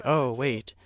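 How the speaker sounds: normal talking voice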